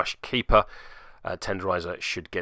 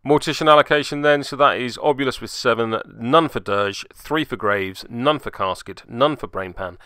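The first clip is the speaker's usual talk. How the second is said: usual speaking voice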